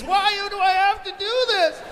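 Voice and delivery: put-on high, whining old lady voice; whiny old lady. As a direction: complain like a put-upon granny. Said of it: "old ladies".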